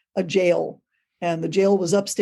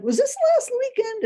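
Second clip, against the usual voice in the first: much higher, eager question; a high, knowing mock coworker voice; coworker asking eagerly. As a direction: ask it like you already know the answer. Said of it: "she said".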